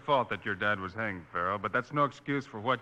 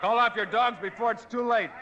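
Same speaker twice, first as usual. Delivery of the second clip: shouted warning; 13 semitones higher than usual. Acted. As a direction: yell a last warning across the street. Marshal warns the lynch mob.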